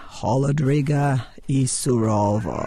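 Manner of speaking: low, phony Russian line; mangled Russian in a low voice; mock movie Russian. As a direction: intone garbled Russian like a submarine captain. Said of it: "saying in Russian".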